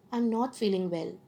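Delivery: soft, subdued, unwell tone; tone matching illness. Shown as a demonstration. Lecturer showing students tone matching words, softly unwell. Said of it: "my tone of voice is going with my words".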